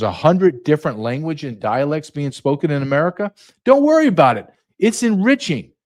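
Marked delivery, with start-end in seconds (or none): 3.6-5.7 high mocking reassurance; mock cheery sarcasm; sarcastic reassurance. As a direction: coo it like a smug booster.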